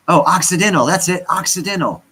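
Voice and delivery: high, loud burst of recognition; sudden recall. Real, not acted. Streamer remembering the forgotten town name, excited recognition. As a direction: blurt it out as it finally comes back.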